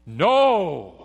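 NO shouted high and loud; emphatic no. Shown as a demonstration. Preacher hammering Paul's word to the congregation, loud No. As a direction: slam the single word No. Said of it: "it's emphatic".

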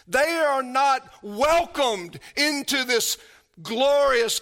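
loud, high, impassioned preaching; preaching at full force. Acted. Preacher pressing the eviction on the church, loud and fervent. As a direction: thunder it to the congregation.

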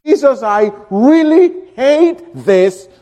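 pained prayer. Pretend. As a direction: wrung out, admitting the hate to Jesus. Voicing a reluctant prayer.

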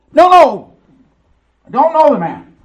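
P=A; panicked denial. Acted. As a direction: blurt it out, cornered and scared. Peter denying Jesus when accused.

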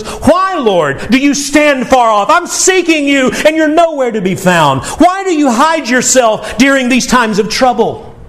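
high pleading lament held through; psalmist's lament. Retold or imitated. Psalmist lamenting to the Lord, high pleading cry. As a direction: cry out to a God who seems far off. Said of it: "the psalmist goes to the Lord and says".

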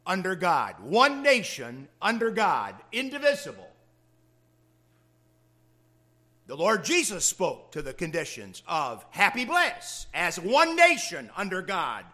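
loud, rising pulpit proclamation; preaching at full force. Acted. Preacher booming the pledge line to the congregation, loud. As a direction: thunder it from the pulpit.

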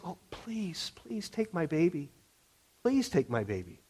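mock a broken, begging parent in a small voice; pleading whimper. Preacher mocking a parent who begs the neighbor, soft whimpering plea.

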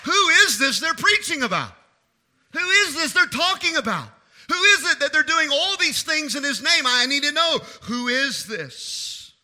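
high, loud, urgent questioning; insistent demanding Herod. Acted. Preacher acting Herod's demand to know about Jesus, insistent. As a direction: demand an answer, pressing harder with each question.

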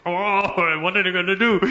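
high-pitched mock panic; freaking out. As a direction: wail it in comic alarm. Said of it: "I started freaking out".

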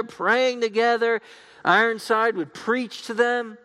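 high, projected pulpit storytelling; animated preaching. Real, not acted. Preacher telling of church services on a train, animated preaching.

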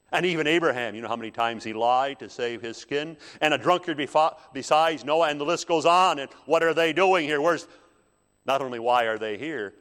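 loud, high, outraged listing of sins; indignant accusation. Acted. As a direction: fire off charges at full voice. Acting out Satan's accusations before God.

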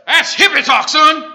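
loud, high mock bellow at his son; gruff father shout. Father in a car ad scoffing at his son, loud mock shout. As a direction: bark it like a cranky old dad. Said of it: "And he says".

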